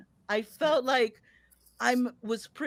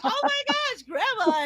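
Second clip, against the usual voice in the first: laughing delight. Retold or imitated. Delighted at grandma.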